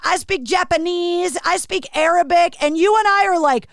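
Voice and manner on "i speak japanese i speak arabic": higher-pitched imitated Brooklyn accent; mock Brooklyn accent, insistent list; put-on Brooklyn accent. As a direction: count them off like a Brooklyn guy. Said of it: "I'm gonna do this accent".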